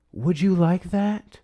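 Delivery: high, slow syrupy offer; mock cooing offer. Host mocking a congressman's call to Netanyahu, slow and syrupy. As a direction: drawl it out like a suck-up.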